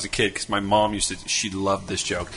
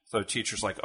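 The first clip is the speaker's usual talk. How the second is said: ordinary talk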